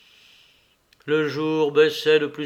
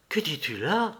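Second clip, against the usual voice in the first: usual speaking voice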